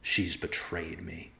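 soft, slow private realization; inner thought. A narrator's reading. Husband realizes his wife betrayed him, inward. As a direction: think it slowly, under the speech level. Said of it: "he thought".

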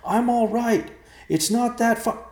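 loud, high shout, cut off; high-pitched holler from below; crying out. Acted. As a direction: yell it up, stopping mid-word. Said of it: "Evercloud cried".

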